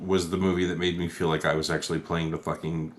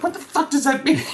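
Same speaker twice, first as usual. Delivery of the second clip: very high, louder squeaky Batman; mock Batman; 5 dB louder than usual. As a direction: squeal the question in confusion. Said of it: "Batman's like".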